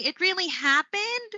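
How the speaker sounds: HAPPENED swept up high at the end in disbelief; rising intonation, disbelief. Shown as a demonstration. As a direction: ask it in disbelief, pitch climbing on "happened". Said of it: "that's how you would sound like when you're indicating disbelief".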